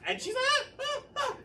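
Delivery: high, mock moans in falsetto; squeaky faked moans; mock moaning. Comedian mimics woman moaning.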